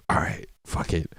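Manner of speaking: whispered, giving up; sighing surrender; resigned whisper. Retold or imitated. Resigned after a sigh.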